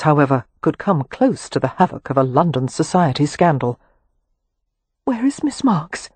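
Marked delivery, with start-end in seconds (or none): 5.0-6.1 muffled voice. A narrator's reading. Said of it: "asked in a muffled voice".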